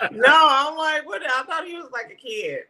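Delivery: high, laughing disbelief. Real, not acted. Laughing at surprise over son.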